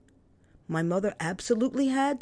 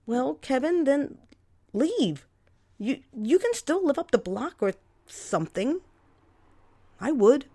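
exasperated retort; 6 semitones higher, 3 dB louder than usual. Acted. Sister arguing with brother.

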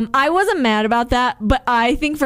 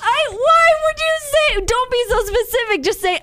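exasperated disbelief; 13 semitones higher than usual. Real, not acted. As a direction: protest in high-pitched disbelief. Exasperated at her tactless breakup words.

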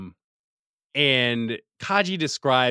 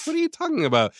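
squeaky voice; much faster than usual. Retold or imitated. Squeaky voiced Shinji reply.

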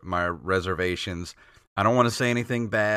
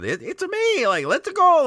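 much higher, louder Mario catchphrases; Mario-isms; 4 dB louder than usual. Retold or imitated. Host shows the Mario voice the actor should keep, high excited Mario voice. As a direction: burst up into a squeaky excited Mario. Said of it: "still give it those Mario-isms".

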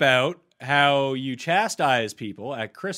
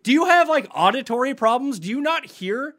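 exasperated; 7 semitones higher, 5 dB louder than usual. Real, not acted. Quarrel over the impression.

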